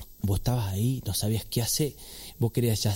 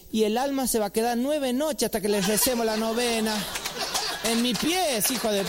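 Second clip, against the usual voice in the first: put-on high, pious old aunt voice; an aunt from San Juan preaching about the novena; preachy aunt; 10 semitones higher than usual. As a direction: intone like a churchy aunt. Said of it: "la tía esa de San Juan que dice".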